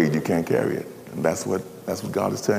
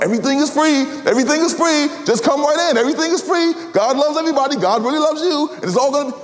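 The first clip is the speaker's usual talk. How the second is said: much higher, bright sales-pitch voice; mock cheerful preacher; 3 dB louder than usual. Preacher mocking easy grace message, much higher. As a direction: sell free grace like a huckster.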